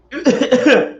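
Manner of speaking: a harsh cough after the shot. Real, not acted. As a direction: cough from the strong liquor.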